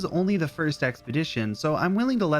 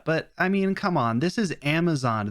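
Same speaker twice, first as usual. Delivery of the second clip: usual speaking voice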